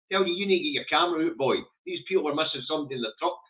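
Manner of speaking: ordinary talk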